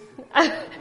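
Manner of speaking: a loud, real laugh at her own joke; burst of laughter. Real, not acted. Speaker laughing at her own Father Christmas joke, loud laugh.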